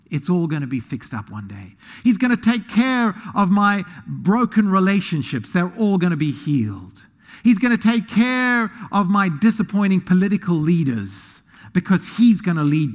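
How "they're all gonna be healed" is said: normal talking voice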